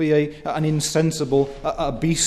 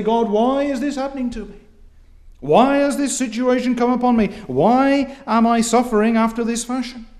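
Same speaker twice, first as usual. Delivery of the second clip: higher, louder, fast aggrieved whining; petulant complaint to God; 6 semitones higher, 4 dB louder than usual. Acted. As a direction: demand answers of God like a wronged child. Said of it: "our attitude is altogether wrong".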